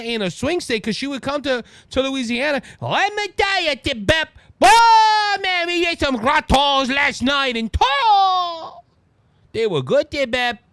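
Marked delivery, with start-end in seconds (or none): 2.8-8.8 hammed-up Cajun accent, high and stretched; loud mock Cajun drawl; Kamala Harris. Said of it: "try to make up a Cajun accent". 9.5-10.6 mock Cajun accent; playful fake Cajun; Kamala Harris. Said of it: "try to make up a Cajun accent".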